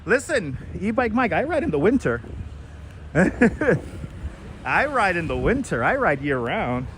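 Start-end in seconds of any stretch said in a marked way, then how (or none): 3.1-5.8 very slow, stretched-out declaration; slow emphatic repeat. Pretend. Vlogger tells E-Bike Mike he rides in winter, slowly. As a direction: drag every word out proudly.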